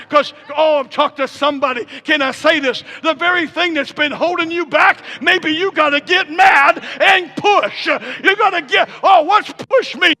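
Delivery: high, loud shouted exhortation building in intensity; full-force preaching. Real, not acted. Preacher urging congregation to push, fervent shouting.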